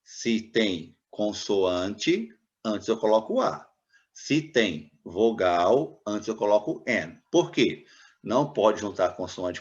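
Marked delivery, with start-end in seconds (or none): 0.0-7.2 slow, spelled-out rule; slow rule recital. Shown as a demonstration. Teacher slowly recites the rule to students, deliberate. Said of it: "A regra geral é".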